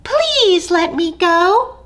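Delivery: pleading tiny mouse; begging mouse. Acted. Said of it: "Mouse begged".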